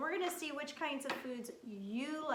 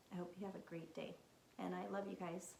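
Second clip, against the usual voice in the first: 1 soft whisper; 4 semitones lower, 7 dB quieter than usual. Real, not acted. Signing off to the children.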